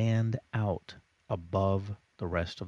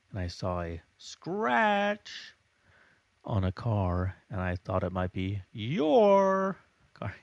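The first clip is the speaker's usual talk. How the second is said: too loud; 4 dB louder, slower than usual. Shown as a demonstration. Teacher showing bad marking, far too loud. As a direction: blurt the command out way too loud. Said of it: "Too loud".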